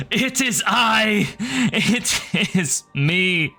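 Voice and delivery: wailing creature. Acted. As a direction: wail it out like a tormented spirit.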